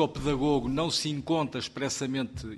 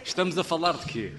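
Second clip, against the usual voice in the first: louder, half-laughing mock reprimand; playful scolding; 8 semitones higher, 3 dB louder than usual. Real, not acted. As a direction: tease them for not listening. Chiding colleagues for chatting.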